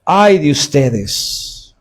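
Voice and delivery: "Ay de ustedes" muy lento, con el "Ay" alargado como en un funeral; lamento fúnebre. Shown as a demonstration. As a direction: arrastra "Ay" como un quejido de duelo. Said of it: "ah, fúnebre". (lamento lento y fúnebre)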